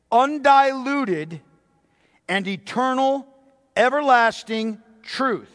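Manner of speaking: slow, deliberate reading of the quote, each phrase weighed; read slowly. Acted. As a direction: lay out every word of the quote slowly and solemnly. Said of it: "I'm gonna read it slowly".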